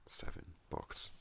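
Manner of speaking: whisper, barely voiced; whispered repeat. Real, not acted. Writer repeating own book count to the viewers, whispered. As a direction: drop to a whisper, as an aside.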